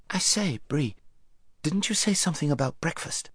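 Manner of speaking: lightly voiced young boy. A narrator's reading. Boy Shasta voiced by narrator.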